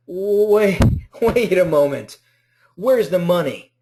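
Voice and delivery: half-laughing disbelief; confused laugh. Retold or imitated. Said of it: "And you're like".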